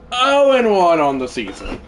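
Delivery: loud, high wail falling away; exasperated sports-fan howl; dismayed cry. Real, not acted. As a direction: cry out the loss, starting high and sinking. Reacting to losing the game.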